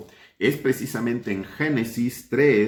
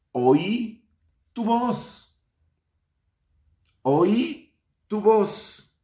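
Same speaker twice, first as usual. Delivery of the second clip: alto y arrastrado, con temor; un hombre escondido que tiembla; Adán asustado; 10 semitones higher, 3 dB louder, much slower than usual. Acted. (Adán responde a Dios con miedo alto y lento)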